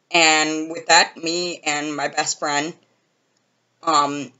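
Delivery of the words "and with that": usual speaking voice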